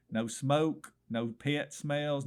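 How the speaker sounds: ordinary delivery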